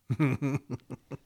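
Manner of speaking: short quiet laugh at himself; soft chuckle. Real, not acted. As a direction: laugh it off softly.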